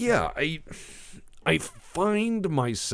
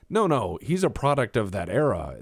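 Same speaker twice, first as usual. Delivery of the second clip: usual speaking voice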